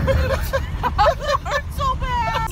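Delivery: laughing in pain. Real, not acted. Laughing while her arm hurts.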